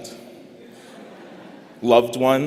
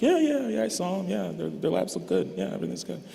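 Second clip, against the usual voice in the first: mock careless doctor brushing it off, starting high then trailing to a near whisper; dismissive doctor; 9 semitones higher, 4 dB quieter than usual. Graduation speaker mocking a doctor glued to the computer, careless brush-off. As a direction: imitate a distracted doctor waving off the patient, voice sliding from high to hushed. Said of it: "hopping on the computer and being like".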